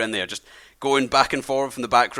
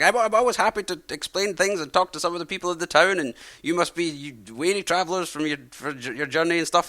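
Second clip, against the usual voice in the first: put-on higher, kindly old man voice; friendly old man; 6 semitones higher than usual. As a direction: play a chatty old host welcoming travellers.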